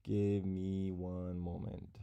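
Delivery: distracted, quiet stalling. Real, not acted. Streamer stalling viewers while checking on a giveaway, distracted and quiet. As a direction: murmur it absently while busy with something else.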